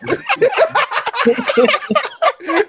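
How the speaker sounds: long high cackle; loud laughing. Real, not acted.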